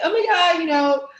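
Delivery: high, flustered fake upset; flustered, hand-wringing mock dismay; mock distress. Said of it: "they're, they're crying".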